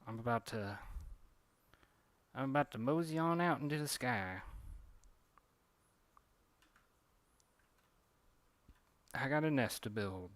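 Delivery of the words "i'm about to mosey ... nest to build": put-on Southern bird accent. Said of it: "it's a southern bird".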